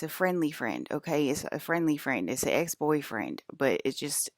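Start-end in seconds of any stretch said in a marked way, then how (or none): none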